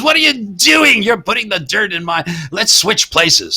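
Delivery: high indignant mock protest; acting out Bud's complaint. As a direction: squeal it out like a fed-up comic. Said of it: "he says".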